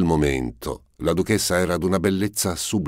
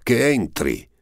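sharp, much higher shouted command; shouted order; 9 semitones higher, 3 dB louder than usual. A narrator's reading. Prince shouts for the count to come in. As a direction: bark the order across the room. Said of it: "gridò il principe".

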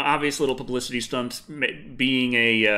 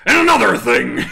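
louder, gruff ranting wrestler voice; Jesse Ventura voice; 6 semitones higher, 10 dB louder than usual. Retold or imitated. Doing Jesse Ventura for a Sundari, booming rant. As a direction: bellow it like a pro wrestler on a rant. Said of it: "the Jesse Ventura voice".

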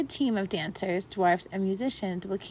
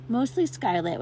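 ordinary delivery, nothing marked

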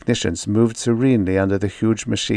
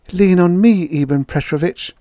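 woman's voice; 9 semitones higher, 4 dB louder than usual. A narrator's reading. Woman supporting him.

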